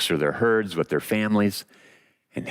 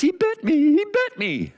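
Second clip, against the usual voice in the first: rozpad emocjonalny lwa; 3 dB louder than usual. Acted. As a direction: wail it like a wounded crybaby lion. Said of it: "The lion falls apart".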